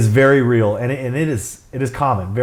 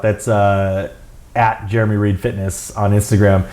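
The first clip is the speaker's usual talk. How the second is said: plain reading voice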